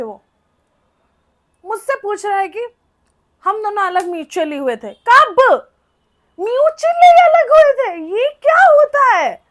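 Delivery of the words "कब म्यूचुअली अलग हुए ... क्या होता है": high, loud, outraged "कब"; outraged disbelief. Retold or imitated. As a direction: fire back in shocked anger, voice up high. Outraged at "mutually. (हैरानी भरे गुस्से में ऊँची आवाज़)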